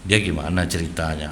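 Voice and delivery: soft, low gentle question; gentle asking. Retold or imitated. Father gently asking his upset child, soft and low. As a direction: ask softly to coax the story out. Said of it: "saya robahlah intonasi saya".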